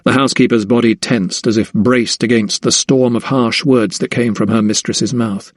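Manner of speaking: usual speaking voice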